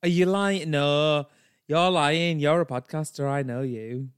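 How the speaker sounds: loud, accusing Yorkshire woman; Brenda. Acted. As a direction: challenge them in broad Yorkshire. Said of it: "she has such a strong Yorkshire accent".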